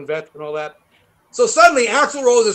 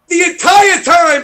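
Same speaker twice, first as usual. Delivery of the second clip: louder, higher, slowed emphasis; slow shout; 8 semitones higher, 8 dB louder, slower than usual. Real, not acted. Stressing how long the horn blared, slow shout. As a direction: stretch and shout it.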